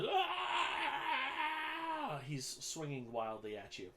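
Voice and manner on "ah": long, high tantrum wail; childish scream. Acted. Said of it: "almost a childish, like, just".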